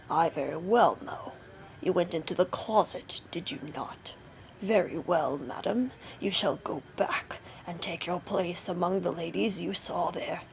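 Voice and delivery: low villainous husband voice; menacing Bluebeard. A narrator's reading. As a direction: pass sentence coldly on the wife. Bluebeard's voice, threatening his wife.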